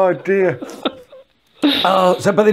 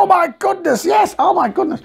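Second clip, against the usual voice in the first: much higher, crying out oh my goodness; shocked realisation; 13 semitones higher than usual. Retold or imitated. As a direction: cry out in sudden shocked recall. Recalled realisation.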